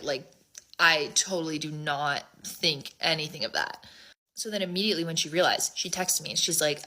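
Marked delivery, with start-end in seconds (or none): none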